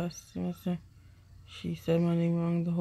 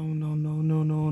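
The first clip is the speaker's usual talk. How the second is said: ordinary delivery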